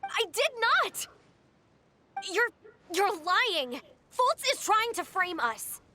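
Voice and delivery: indignant denial. Acted. Accused member protesting to the others that it is a lie, indignant. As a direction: deny it hotly, protesting a frame-up. Denying an accusation in an acted confrontation.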